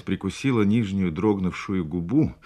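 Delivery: ordinary delivery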